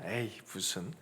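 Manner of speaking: scoffing dismissal. Retold or imitated. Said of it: "이런 말이에요".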